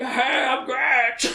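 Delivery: high, slow, silly creature greeting; hammed-up creature introducing itself; mock monster saying hi; Gratch voice.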